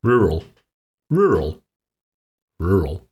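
rural as an isolated word, repeated with pauses; demonstrated pronunciation. Shown as a demonstration. Panellist takes his turn showing the table, rural pronounced deliberately. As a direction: say rural as a careful sample, falling each time. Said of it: "say this word in the way".

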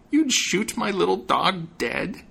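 high, slow, outraged witch; a witch's indignant cry; angry witch. A narrator's reading. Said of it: "the witch cried angrily".

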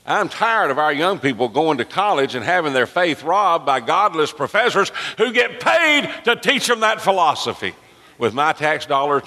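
high, impassioned pulpit protest; indignant preaching. Real, not acted. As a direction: preach your outrage, pitch climbing as you go. Preaches indignantly at full force.

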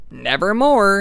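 a lightly voiced raven, loud and falling; hint of an ominous raven; raven's answer. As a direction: croak it out like the bird. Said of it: "And the bird's like".

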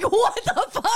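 high, loud laughing disbelief; shocked laugh. Retold or imitated. Reacting to her cohost's yell, shocked laughing. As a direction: gasp it out through laughter. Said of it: "And I was like".

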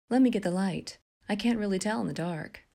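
sweet-talking, sleazy charm; cooing. A narrator's reading. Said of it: "Nathan cooed back to her".

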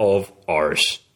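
slow West Country grumble; West Country accent. Shown as a demonstration. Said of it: "we now need to say arse in a West Country accent".

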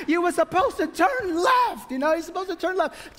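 high, exasperated mock scolding of the driver; nagging passenger. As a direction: play the annoyed map reader correcting the driver.

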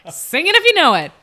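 hammed-up ecstatic yell; mock climax shout. Riffing on favorite climax line, high loud shout.

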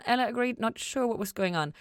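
ordinary delivery

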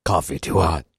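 low terse deadpan read; grim clipped vigilante diary; Rorschach voice. Retold or imitated. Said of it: "you read it as Rorschach".

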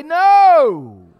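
loud, high shouted "No". Shown as a demonstration. Preacher leading the congregation, shouting "No" loud and high. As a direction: lead the church in a loud "No". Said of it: "Everybody say".

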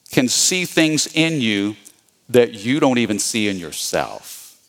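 normal talking voice

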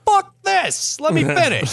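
very high indignant squeal; outraged high squeal. Pretend. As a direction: shriek it in gamer outrage. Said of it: "It's like".

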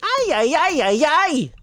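high-pitched robot sidekick wail; comic high "ay" cries; Alpha. Retold or imitated. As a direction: imitate a fretting little robot, way up high. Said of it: "Imagine Alpha".

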